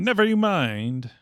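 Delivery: hammed-up Clark dodging questions; mock evasive hero. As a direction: brush it off with a loud sing-song dodge. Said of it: "It's like the Jedi mind trick".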